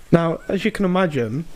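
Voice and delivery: ordinary delivery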